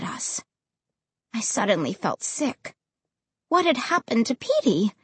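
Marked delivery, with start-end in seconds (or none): none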